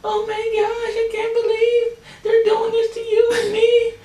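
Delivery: very high, squeaky mock sobbing apology; imitating crying lady. Vlogger mimics the sobbing rental lady apologizing, high mock crying. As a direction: ham up a clerk breaking down in tears. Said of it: "looking at this lady breaking down".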